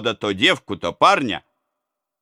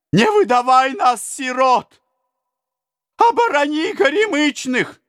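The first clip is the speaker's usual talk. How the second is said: desperate plea. A narrator's reading. Peasants beg the prince.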